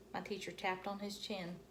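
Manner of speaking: usual speaking voice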